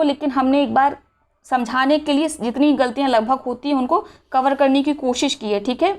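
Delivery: normal talking voice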